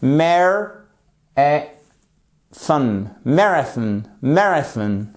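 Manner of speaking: "Marathon" broken into parts, then whole, MAR strongest; stress on first syllable. Shown as a demonstration. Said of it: "Vous avez l'accentuation sur la première syllabe". (« Marathon » découpé puis entier, accent sur la première syllabe)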